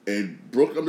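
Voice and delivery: ordinary talk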